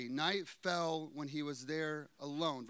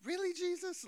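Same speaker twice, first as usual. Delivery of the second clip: much higher, mock incredulous squeak; 13 semitones higher than usual. Preacher imagining herself as Peter, mock incredulous. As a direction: act shocked at Jesus. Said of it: "I would have been like".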